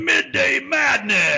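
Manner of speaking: high, slow, hyped monster truck voice. Shown as a demonstration. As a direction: stretch it like a monster truck commercial. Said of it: "say it like a, the guy on the monster truck commercial".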